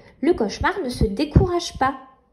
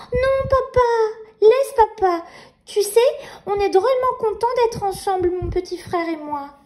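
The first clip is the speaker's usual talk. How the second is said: put-on little girl voice; 7 semitones higher than usual. Nina answering Papa.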